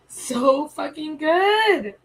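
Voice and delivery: high, loud, drawn-out wail of praise; overcome with emotion. Real, not acted. Streamer moved by the song, overcome and wailing. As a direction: stretch it out slowly, voice lifted with feeling. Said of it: "super emotional".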